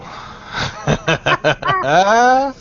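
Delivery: drawn-out burst of laughter; long laugh. Real, not acted. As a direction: laugh on and on, climbing in pitch. Reacting to who just popped in.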